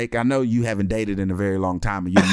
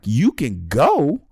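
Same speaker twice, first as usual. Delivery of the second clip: mock defiant inner thoughts, building louder; 4 semitones higher, 5 dB louder than usual. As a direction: let the defiance swell up. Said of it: "thinking to myself in my mind".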